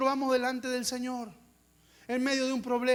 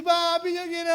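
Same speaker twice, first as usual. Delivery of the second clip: lightly voiced whining daughter; whiny child; 7 semitones higher, 6 dB louder than usual. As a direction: whine like a little girl asking. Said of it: "viene mi hija y me dice".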